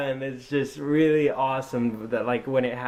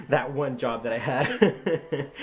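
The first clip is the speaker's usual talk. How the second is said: words breaking into real laughter; laughing; slower than usual. Real, not acted. Uncle joking about his one-day job, laughing. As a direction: laugh through the end of the line.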